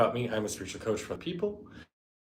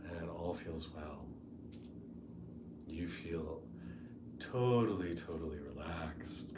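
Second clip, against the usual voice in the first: soft, unhurried meditation voice with long pauses; guided meditation; 7 dB quieter than usual. Real, not acted. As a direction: ease them gently into the imagery.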